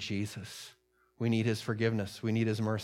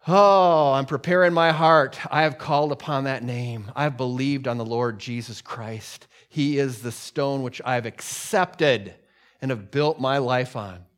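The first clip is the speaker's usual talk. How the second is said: loud, raised proclamation of faith; full-force preaching; 2 semitones higher, 9 dB louder, slower than usual. Real, not acted. Preacher testifies of his faith to the congregation, full-force proclamation. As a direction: open with a big lifted cry and keep declaring.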